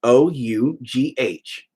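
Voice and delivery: O U G H letter by letter, slow; slow spelling of O U G H; spelled out slowly. Shown as a demonstration. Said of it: "because it's a H".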